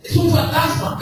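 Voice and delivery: frightened cry. A narrator's reading. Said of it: "gritaban de miedo".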